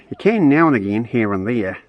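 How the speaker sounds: ordinary talk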